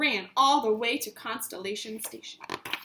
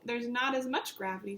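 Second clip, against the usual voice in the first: usual speaking voice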